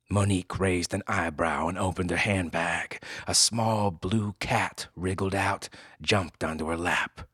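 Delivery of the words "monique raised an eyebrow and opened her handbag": ordinary delivery, nothing marked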